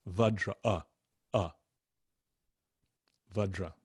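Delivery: "Vajra" as VUH-jruh, with "uh" singled out; uh vowel. Shown as a demonstration. Teacher drills the U H sound in English, short vowel. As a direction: isolate a short "uh", then put it into "Vajra". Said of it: "a U H in, in English".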